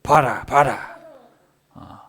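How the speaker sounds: forceful, loud bursts; acted stern commands; displeased divine command. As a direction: order them to look, as God. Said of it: "이렇게 명령을 하시는 거는".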